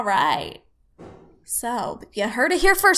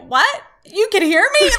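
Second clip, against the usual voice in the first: surprised; 6 semitones higher, 5 dB louder than usual. Retold or imitated. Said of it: "She's like".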